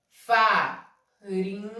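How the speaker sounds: "Farinha" very slow, split as fa... ri... nha; syllable by syllable. Shown as a demonstration. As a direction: sound out "Farinha" while writing it. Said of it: "deixa eu botar no quadro aqui".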